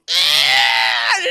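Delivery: low, loud drawn-out roar; monster roar. Retold or imitated. Imitating the caterpillar monster bursting out, roaring. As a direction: bellow like a beast bursting out.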